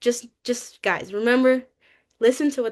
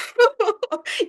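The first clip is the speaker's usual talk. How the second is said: high-pitched breathy giggle; giggling, pitched way up; 10 semitones higher than usual. Real, not acted. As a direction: let a squeaky laugh spill out. Laughing after the quote.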